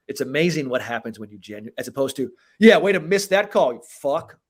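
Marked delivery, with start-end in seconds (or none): 2.6-4.4 loud mocking praise that turns into an insult; sarcastic jab. Player mocking a ref over a missed call, sarcastic. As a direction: needle the ref with fake approval. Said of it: "as opposed to some sarcastic shit".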